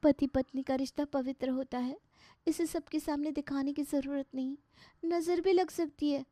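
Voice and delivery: a modest new wife gently holding her ground; a bashful bride preaching old-fashioned values; shy traditional bride. Acted. Voices the bride Neelam.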